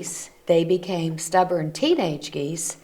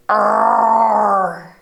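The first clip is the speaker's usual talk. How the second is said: louder growling "Arr!"; grumpy bear roar; 3 semitones higher, 7 dB louder than usual. Acted. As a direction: roar at them like a fed-up bear.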